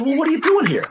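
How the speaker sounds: high-pitched puzzled challenge; retold bafflement. Co-star questioning a supposed cop, high baffled challenge. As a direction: demand it in a high, mock-baffled voice.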